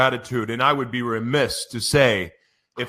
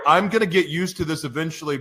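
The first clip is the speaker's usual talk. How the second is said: ordinary delivery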